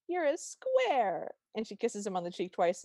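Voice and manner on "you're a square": doting tease. Retold or imitated. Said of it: "and then she goes".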